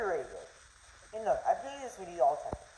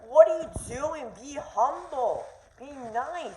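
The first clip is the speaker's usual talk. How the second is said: high, preachy mock voice of a fussy critic; mock scolding critic; 6 semitones higher, 4 dB louder, slower than usual. Vlogger mocking critics who tell players to be humble, high scolding voice. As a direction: whine it like a scold telling someone to behave.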